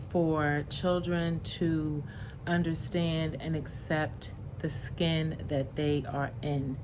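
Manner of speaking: ordinary delivery, nothing marked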